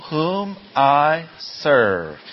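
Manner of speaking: very slow, every word of "whom I serve" held long; emphatic recitation. Shown as a demonstration. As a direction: stretch "whom", "I", "serve", stepping down to a fall. Repeating verse after Greek word.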